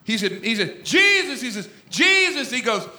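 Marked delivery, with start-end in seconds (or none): 0.9-1.4 high hollered cry for help; raised, urgent mock yell; Peter yelling. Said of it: "Peter yells, he hollers". 1.9-2.5 high hollered call; urgent mock holler; Peter yelling. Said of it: "he hollers".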